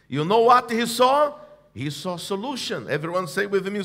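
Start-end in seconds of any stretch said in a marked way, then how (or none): none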